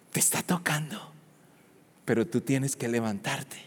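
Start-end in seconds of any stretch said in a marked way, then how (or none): 0.1-1.1 soft, hushed whisper; whispered promise. Real, not acted. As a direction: lean in and breathe it to the congregation. Intimate altar moment, unvoiced.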